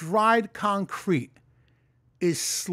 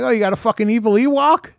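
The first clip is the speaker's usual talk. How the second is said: loud, fast taunting sneer; mock the creature over the phone; louder, quicker teasing jab; 5 semitones higher, 5 dB louder, faster than usual. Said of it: "starts making fun of this thing".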